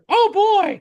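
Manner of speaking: very high, loud squeaky falsetto; Mickey Mouse. Acted. Storyteller showing how everybody greeted the voice actor, high cartoon squeal. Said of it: "meet the voice of Mickey".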